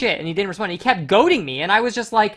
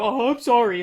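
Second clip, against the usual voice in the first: mock meek apology; 8 semitones higher than usual. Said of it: "you go".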